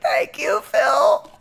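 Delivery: high, shouted gushing thanks; fanboy shout. Pretend. Fan greeting host Phil on air, high shouted thanks. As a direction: shout thanks at your idol. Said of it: "me, like".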